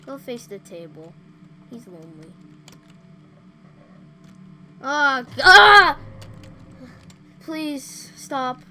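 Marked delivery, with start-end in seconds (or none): 4.8-8.6 loud high cries of fright, then begging; scream, then beg it to stop; frightened scream. Real, not acted. Screams at jump scare.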